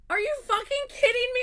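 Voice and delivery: very high, loud incredulous shout; hammed-up disbelief at the friend; outraged yell. Yelling at Allison over the album, shrill outraged shout.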